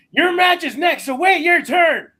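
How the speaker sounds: high, loud scolding; angry announcer's acted holler; yelling. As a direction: shout them off backstage. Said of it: "yelling at me".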